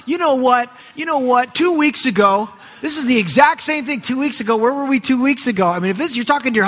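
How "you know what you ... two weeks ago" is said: higher, accusing "you know what" repeated; imitating a wife who finally lets it out; accusing spouse. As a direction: dredge up the old fight, pointed. Spouse finally airs old grievances.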